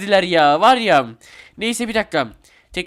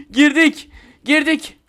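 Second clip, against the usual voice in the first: high-pitched laughing triumph; gleeful excitement; 8 semitones higher than usual. Real, not acted. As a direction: burst out laughing at getting in. Gets through the door, celebrating. (sevinçle, gülerek)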